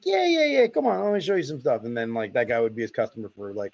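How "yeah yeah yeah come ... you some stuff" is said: high, insistent Brooklyn salesman imitation; Brooklyn accent. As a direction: brush off the objection in mock Brooklyn. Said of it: "my dad, a real Brooklyn accent".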